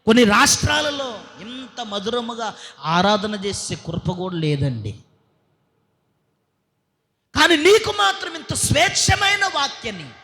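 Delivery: shouting from the pulpit; fiery preaching. Acted. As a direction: preach it with full force. Preaching at full force.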